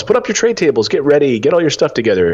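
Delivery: retelling the cabin crew announcing the landing; flight attendant call. Retold or imitated. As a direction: mimic the crew prepping for landing.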